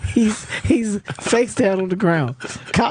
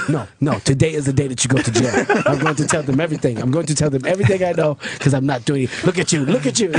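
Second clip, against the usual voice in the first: fast imitated African accent, indignant; African-accented mock tirade; 4 semitones lower, faster than usual. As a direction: imitate your African friend refusing to cover for anyone. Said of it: "he's got this African accent".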